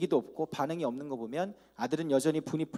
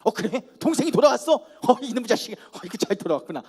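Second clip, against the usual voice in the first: glad brother; 5 semitones higher, 7 dB louder than usual. Retold or imitated. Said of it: "보통 형 같으면 어떻게 해야 될까요".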